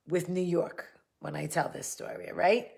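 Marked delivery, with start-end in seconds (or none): none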